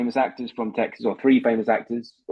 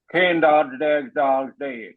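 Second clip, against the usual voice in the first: very slow Texan, jaw held still; still-jaw Texan demo; much slower than usual. Shown as a demonstration. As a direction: Texas line with the jaw locked, slowly. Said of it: "You're moving your jaw too much".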